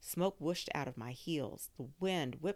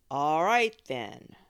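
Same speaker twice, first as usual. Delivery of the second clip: a touch of a weary brush-off; louder, dismissive adult ending the chat; tired grown-up voice; 12 dB louder, slower than usual. Acted. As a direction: wrap it up, ready to get back to your own stuff. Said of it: "in that gr-, voice grown-ups use when they're tired of you".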